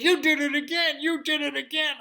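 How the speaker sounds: loud high accusation; accusing guard. Acted. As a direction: blame him loudly.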